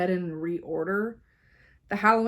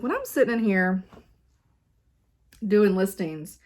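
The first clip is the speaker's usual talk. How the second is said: ordinary delivery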